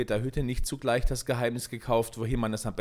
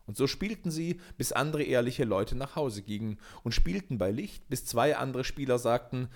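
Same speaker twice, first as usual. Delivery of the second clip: plain reading voice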